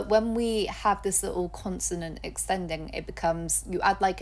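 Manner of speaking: normal talking voice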